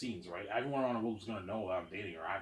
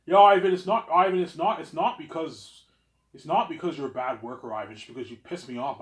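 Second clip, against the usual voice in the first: mock manager scolding; 4 semitones higher, 9 dB louder than usual. Manager's voice, retold loudly.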